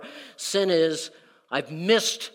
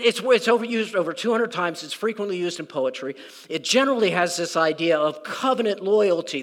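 ordinary talk, nothing marked

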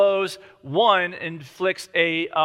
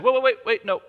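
urgent protest; 5 semitones higher than usual. Retold or imitated. Widow stopping the king before he moves on, urgent. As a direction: cut him off in a rush, higher. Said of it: "She's like".

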